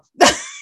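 loud laugh bursting out; loud burst of laughter. Real, not acted. Telling the coach about the date, bursting into loud laughter.

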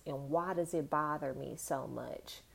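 whispered question to herself; hushed inner thought. Real, not acted. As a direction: wonder it under your breath. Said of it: "I've been thinking about".

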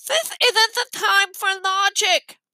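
high, slow objection with a blocked nose; stuffed-nose voice. A narrator's reading. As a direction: object firmly through a pinched nose. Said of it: "they had the worst colds".

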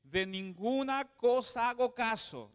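ordinary delivery

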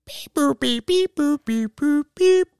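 high monotone robot beeping; playful mock computer bleeps; robot beeps. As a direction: imitate electronic beeps and boops on one pitch. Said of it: "When I do the robot".

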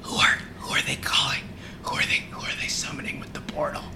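nervous whispered question; mock whisper of a new recruit; whispering newbie. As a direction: whisper it from the background. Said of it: "he's like".

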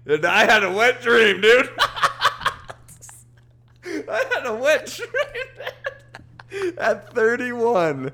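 high, breaking into laughter; laughing. Real, not acted. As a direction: laugh through the confession. Laughing while confessing dream.

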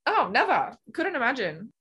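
playful sarcasm. Pretend. Swimmer joking about never tiring, playful sarcasm. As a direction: agree with exaggerated, singsong irony.

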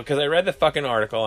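ordinary talk, nothing marked

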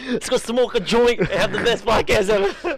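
high, slow, laughing mock dad; giggling imitation of hyped father; laughing through quote. Laughing while quoting dad.

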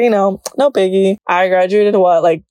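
ordinary talk

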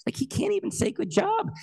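very high, squeaky panicked disbelief; hurt child's alarmed inner voice; terrified kid. Retold or imitated. Child doubting dad's love, terrified. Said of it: "it terrified me".

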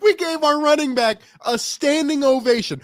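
incredulous fan yelling at the absurdity; exasperated outburst. Real, not acted. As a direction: shout it like you can't believe it happened. Outraged fan ranting about play calling.

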